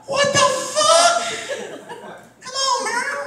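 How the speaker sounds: very high, slow squeaky cat voice; squeaky cartoon cat grumbling; confused cats. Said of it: "cats ... standing around going".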